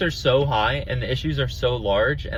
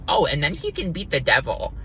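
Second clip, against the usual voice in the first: mocking quote; 3 semitones higher than usual. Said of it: "it's just like".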